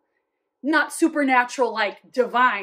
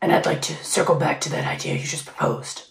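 fast breathy whisper in a disguise; costume whisper; 9 semitones lower, faster than usual. Pretend. Dressed-up coworker whispering a meeting line, fast. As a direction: hush it out like a man in costume. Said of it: "always coming in a different costume".

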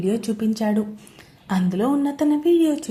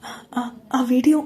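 slow, halting, stammered words; a shaken woman barely getting the words out; struggling to speak. Acted. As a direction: force each word out through shock. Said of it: "అంది కష్టంగా".

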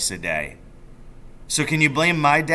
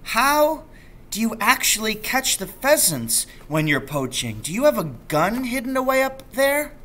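put-on higher, eager boy's voice; a child eagerly quizzing his dad; young boy asking; 12 semitones higher than usual. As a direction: lift into a curious child. Narrator voices young Danny.

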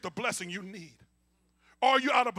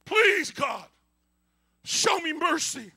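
loud, high plea to God; impassioned cry of prayer; bold prayer; 4 semitones higher, 6 dB louder, much slower than usual. Acted. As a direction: beg for mercy, stretching the words. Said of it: "That's what the bold prayer does".

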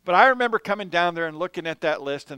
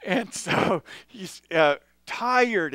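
normal talking voice

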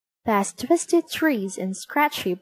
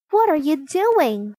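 high, alarmed storybook cry; mild shout of alarm, pitched up; 6 semitones higher, 3 dB louder than usual. A narrator's reading. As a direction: call out in alarm, without full volume. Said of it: "she shouted in alarm".

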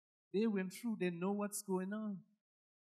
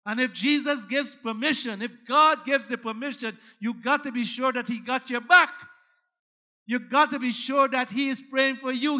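loud, high, rising preacher's fervor; whooping climax of the sermon; full-force preaching; 5 semitones higher, 13 dB louder than usual. Acted. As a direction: drive it home to the congregation.

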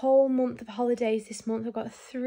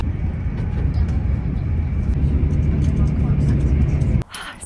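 long, low, loud breathy exhale; heavy sigh; 9 dB louder than usual. Real, not acted. Big sigh after making the train on time. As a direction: let it all out after the sprint.

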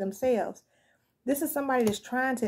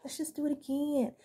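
coaxing tempter; 5 semitones higher, 6 dB quieter than usual. Retold or imitated. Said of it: "keep coming to them like".

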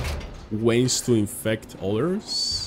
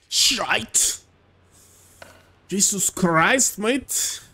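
higher, exaggerated Scottish-Irish accent; 8 semitones higher, much slower than usual. Streamer echoing the game character's curse, mock Scottish-Irish accent. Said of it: "Speaking that accent for the rest of the stream".

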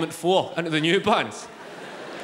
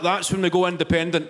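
ordinary talk

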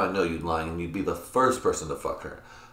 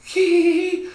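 very high, loud "hee" squeal; shrill-pitched, hammed-up squeal; mock giddy squeal; 7 dB louder, slower than usual. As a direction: imitate a mom squealing her entrance. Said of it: "she's like".

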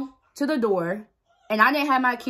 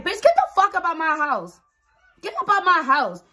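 higher-pitched retold yell at the intruder; mock yell; 8 semitones higher than usual. Yelling at the woman hiding in her closet to get out of her house, with a mock angry yell. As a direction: throw her out with a high, sharp yell.

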